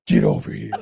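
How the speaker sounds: deep mock growl; low growling mock voice; demon voice. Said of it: "you sound like Batman".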